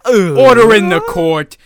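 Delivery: booming slow courtroom call; judge voice. Pretend. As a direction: announce it like a stern judge. Podcast opening judge bit.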